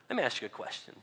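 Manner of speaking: ordinary talk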